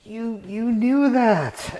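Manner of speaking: high, slow gushing amazement; amazed friend. Retold or imitated. Friend amazed he knew the film, high slow gush. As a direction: gush it out slowly, high and awed. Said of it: "just like".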